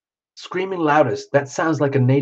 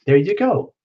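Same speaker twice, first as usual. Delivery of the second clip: ordinary delivery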